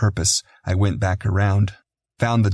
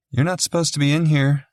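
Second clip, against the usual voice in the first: higher-pitched woman's voice; nurse; 7 semitones higher than usual. A narrator's reading. Nurse finding an intruder in the nursery, higher voice. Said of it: "said a woman wearing scrubs".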